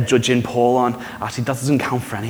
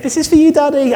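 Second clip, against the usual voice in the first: put-on high, eager child's voice; little child voice; 14 semitones higher, 5 dB louder than usual. Child hands their dad a drawing, high child voice. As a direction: squeak it out like a small kid handing over a picture. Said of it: "are like a child's drawing".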